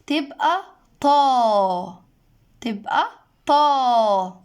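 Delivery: طا drawn out slowly with an open a; elongated fatha. Shown as a demonstration. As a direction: stretch طا long and open. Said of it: "مش بنطق الحرف زي ما هو". (نطق طا بفتحة ممدودة)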